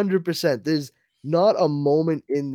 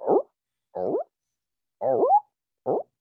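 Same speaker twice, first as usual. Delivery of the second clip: dog whimpers; 14 semitones higher than usual. Retold or imitated. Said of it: "the dog going".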